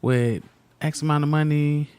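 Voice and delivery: mock indignant. Husband imitates wife's annoyed reaction, mock indignant. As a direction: play the annoyed wife. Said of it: "And you was like".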